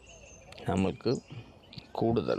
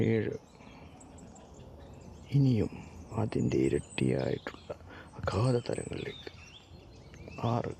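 soft, unhurried meditation voice with long pauses; guided meditation; 6 dB quieter than usual. Acted. Leads a countdown meditation.

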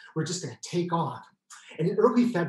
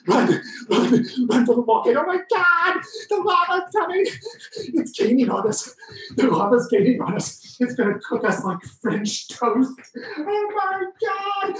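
higher, louder hammed-up fleeing cries; mock panic; 11 semitones higher, 4 dB louder than usual. Urging audience to run from pretend lava, mock panic. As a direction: sell the chase like a B-movie.

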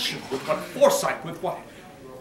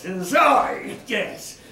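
slow, loud, dragged out with obsession; a madman's loud slow fixation; slow intense outburst; 7 semitones higher, 7 dB louder, much slower than usual. Acted. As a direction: spit it out slowly, loud and fixated. Obsessed narrator fixates on eye.

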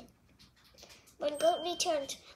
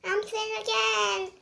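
higher, slow and happy; happily; 6 semitones higher, 4 dB louder than usual. A narrator's reading. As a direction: savour the news, higher and glad. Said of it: "he said happily".

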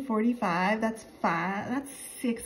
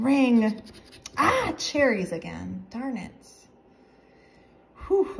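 normal talking voice

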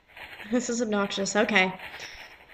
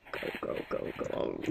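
whispered urging; 15 dB quieter than usual. Real, not acted. Player urging while sneaking.

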